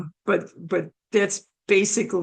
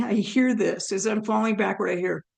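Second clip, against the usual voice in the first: normal talking voice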